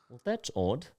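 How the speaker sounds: soft put-on accent; hushed showman in a put-on accent; Cheese Man accent. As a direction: put on the performer's accent, quietly. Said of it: "I gotta do his accent".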